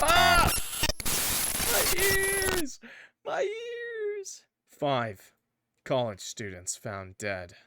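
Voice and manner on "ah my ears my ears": very high screaming my ears; pained scream. Acted. Character being deleted, screaming in pain. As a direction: shriek as if hurt.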